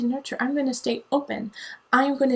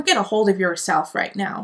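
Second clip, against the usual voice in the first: normal talking voice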